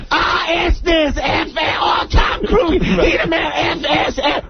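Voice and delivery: very high, excited mimicry; high-pitched hammed-up rant; mock ranting woman. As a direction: squawk it as a caricature of her. Mimics the woman's own loud swearing.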